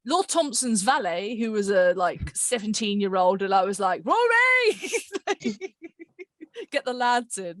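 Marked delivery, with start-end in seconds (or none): 4.1-7.6 excited. Retold or imitated. Excited casting call.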